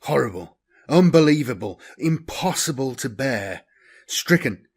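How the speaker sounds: usual speaking voice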